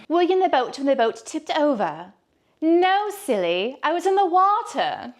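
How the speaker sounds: hammed-up high British accent, badly faked; British accent attempt. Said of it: "a British accent thing".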